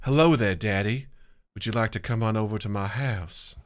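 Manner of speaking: a creepy take on sexy, as if he were Hannibal Lecter; sultry voice. Pretend. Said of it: "Let me try this".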